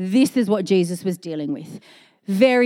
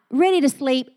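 normal talking voice

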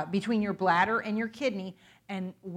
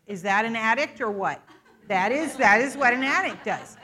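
ordinary talk, nothing marked

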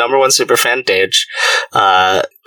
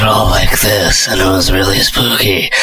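low haunting voice; 4 semitones lower than usual. Shown as a demonstration. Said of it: "do a spooky voice".

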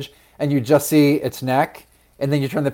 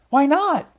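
very high, quick comeback; high-pitched little-seed protest; challenging question; 14 semitones higher than usual. A narrator's reading. Louise challenges Merwin.

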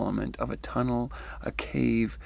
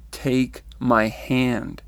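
slow, spaced-out, firm insistence; firm command; 4 dB louder, much slower than usual. Retold or imitated. Being holds out his hand to her, firmly. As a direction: say it firmly, each word drawn out.

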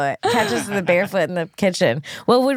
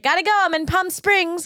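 breezy brush-off; 10 semitones higher than usual. Retold or imitated. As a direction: toss it off, already gone. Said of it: "And you're like".

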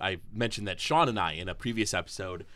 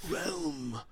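very high, very slow eerie whisper; creepy whisper; much slower than usual. Retold or imitated. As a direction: breathe it out like a ghost child. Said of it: "this harsh, creepy voice".

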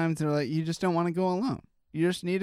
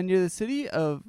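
normal talking voice

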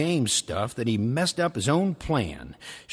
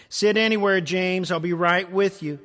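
higher, louder waitress call; 7 semitones higher, 3 dB louder than usual. Acted. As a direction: call across the diner. Waitress calling out.

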